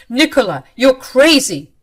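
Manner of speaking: high, loud accusing shout; angry yell. Acted. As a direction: yell it right at her. Husband yells at wife.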